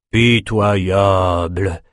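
very slow, drawn-out contempt; withering old mentor's verdict; scornful. A narrator's reading. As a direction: stretch the word out in disdain. Mentor scorns the youth's refusal.

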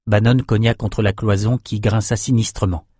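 ordinary delivery

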